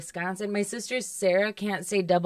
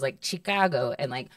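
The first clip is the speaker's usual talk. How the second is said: ordinary delivery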